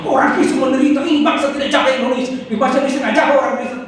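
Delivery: high, fast, worked-up protest; indignant complaint. Retold or imitated. Preacher mimics a congregant's indignant grievance. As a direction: complain as if wronged and demanding. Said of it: "coba kalau saya bilang ini".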